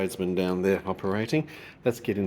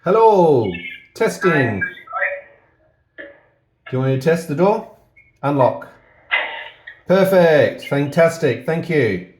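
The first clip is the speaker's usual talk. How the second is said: calling into intercom; 4 semitones higher, 5 dB louder, slower than usual. Real, not acted. Testing the intercom door.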